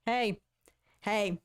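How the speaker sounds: ordinary delivery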